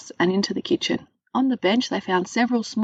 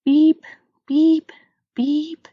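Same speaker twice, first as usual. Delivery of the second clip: three bright, high beeps like an oven timer; high electronic beeping; 10 semitones higher, 6 dB louder, much slower than usual. Pretend. Storyteller imitating the oven timer, high beeps. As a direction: chirp each beep up high, rising at the end.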